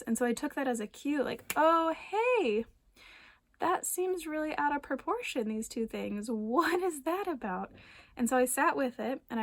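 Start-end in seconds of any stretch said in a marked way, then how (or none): 1.6-7.7 high, lifted 'oh hey' of sudden insight; curious realization. Pretend. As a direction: notice something odd and turn toward it. Voicing her own inner realization.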